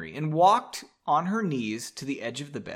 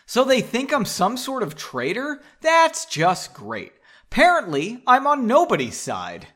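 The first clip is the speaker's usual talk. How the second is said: higher, louder sarcastic outburst; aggrieved and loud, voice pitched up; indignant; 9 semitones higher, 6 dB louder than usual. A narrator's reading. Henry complains of being thought a traitor, indignant. Upset Henry retorts, acted.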